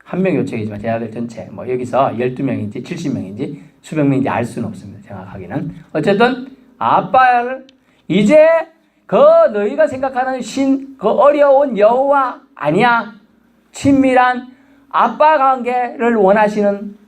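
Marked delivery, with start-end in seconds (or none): 5.9-17.0 high, slow, emphatic preaching; fervent preaching. Acted. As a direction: proclaim it with rising fervor, slowly. Preaching at full force.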